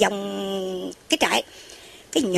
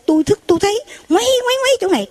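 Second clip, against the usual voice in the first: excited high telling; 9 semitones higher, faster than usual. Retold or imitated. Monk acts out swirling light.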